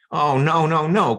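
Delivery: high, loud chain of alarm; dismayed cry. A narrator's reading. Mice and squirrels hear fire is near, dismayed cry. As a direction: cry out in rising panic. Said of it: "cried the mice and the squirrels".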